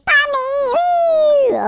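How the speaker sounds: high, loud, stretched-out cheer; drawn-out high woo; playful whoop. Pretend. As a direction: cry out for mommy like a kid on a ride. Cheering a jump.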